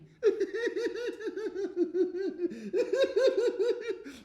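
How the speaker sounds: long, high-pitched helpless laugh; laughing till crying. Acted. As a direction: laugh until you weep, loudly. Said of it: "He's laughing, but he's crying".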